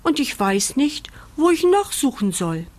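lightly acted puppy close to tears; tearful. A narrator's reading. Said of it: "schluchzt Bandit".

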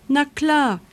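high, bright-idea exclamation; sudden delighted idea. A narrator's reading. Mole hits on an answer for the dog, delighted. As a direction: pitch it up as if it just clicked.

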